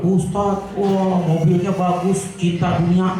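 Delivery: lightly voiced sarcastic critic of the preacher's car; snide online commenter. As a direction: drag it out like a sneering netizen. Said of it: "Ada orang ngomongin ustad".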